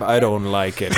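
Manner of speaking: low, deadpan villain reply; Pain. Acted. Pain answers Itachi on crime, low and deadpan. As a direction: drop the pitch right down. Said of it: "can you do a Pain voice".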